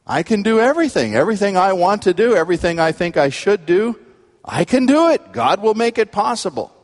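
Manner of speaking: high, eager, self-assured claim; mock overconfidence. Preacher voicing people plucking the verse from context, mock overconfidence. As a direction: pile up the claims in a high, insistent run.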